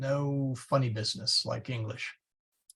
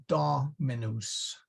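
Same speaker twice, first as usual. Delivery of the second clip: "dominus" as DO-mi-nus; third-last stress; 4 dB quieter, slower than usual. Shown as a demonstration. Teacher explaining why we say it so, first-syllable stress. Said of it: "you still put the accent there".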